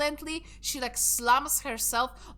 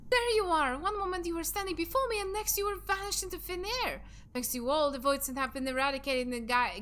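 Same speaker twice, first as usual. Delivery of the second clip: put-on young character voice; 3 semitones higher, faster than usual. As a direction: play a bright young hero greeting the party. Voicing a game character.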